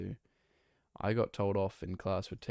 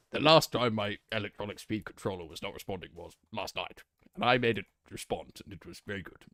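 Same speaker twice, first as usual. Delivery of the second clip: British politician impression, starting loud; Boris Johnson; 5 dB louder than usual. Streamer answering chat's request about speed controller, British politician impression. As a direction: orate like a British statesman, dropping off at the end. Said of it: "do an impression of Boris Johnson".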